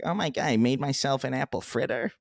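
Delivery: mock-whiny, over-explaining storyteller; whiny friend impression. Mimicking a friend who over-explains his weekend, whiny. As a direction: draw it out in a whiny, softened voice. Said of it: "it's, like, the whininess of it".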